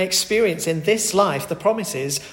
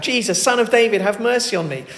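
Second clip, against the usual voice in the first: higher, louder plea for mercy; calling out; 5 semitones higher, 4 dB louder than usual. Retold or imitated. Blind man calls out to Jesus for mercy. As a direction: call out to a passing crowd. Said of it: "And so he calls out".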